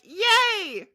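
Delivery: high, loud little cheer; playful fan cheer, high and loud; mock excited cheer. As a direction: squeal it like a fan. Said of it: "And I was like".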